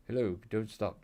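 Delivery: usual speaking voice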